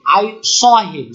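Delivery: very slow I saw him, stretching the s in saw; slow pronunciation demo. Shown as a demonstration. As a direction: draw out saw with a thick s. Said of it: "যেমন I see him". (ধীরে saw উচ্চারণ)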